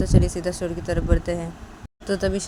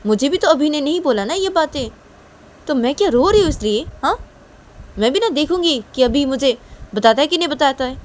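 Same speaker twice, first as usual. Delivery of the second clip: raised, emphatic big-sister voice; higher, louder story voice, put out; indignant elder sister; 7 semitones higher, 8 dB louder than usual. Acted. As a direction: protest firmly as the tougher sister.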